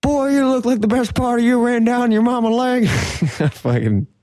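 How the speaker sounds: high, yelling Hartman voice; drill sergeant. Retold or imitated. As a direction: bark insults at a recruit. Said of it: "drill sergeant from fucking Full Metal Jacket".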